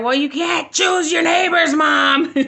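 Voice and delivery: loud, high exasperated shout; shouting back in frustration at mom; upset shout. Retold or imitated. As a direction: yell it back at your mom, fed up. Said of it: "I got so upset".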